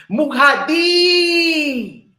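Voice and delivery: drawn-out, high, loud mock of an ancient name; mocking the ancient tongue. Preacher mocking those who talk like the ancients, drawn-out and loud. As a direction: stretch it out like a showy ancient speaker. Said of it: "talk like the ancient".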